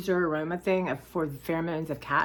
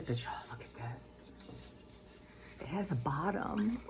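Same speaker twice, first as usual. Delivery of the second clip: hushed whisper, slowed down; 3 semitones lower, 13 dB quieter than usual. Real, not acted. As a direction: whisper it like a secret. Showing off the cup, hushed.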